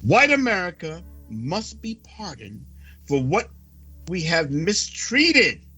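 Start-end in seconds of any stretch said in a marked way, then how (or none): none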